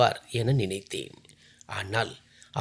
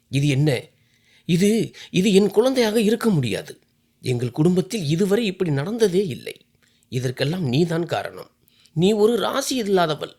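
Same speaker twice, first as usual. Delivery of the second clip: higher and louder, furious accusing yell; angry shouting; 5 semitones higher, 3 dB louder than usual. Acted. Husband Sameer yelling at his wife over the baby, furious. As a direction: blame her at the top of your voice. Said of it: "Sameer கத்தினான்".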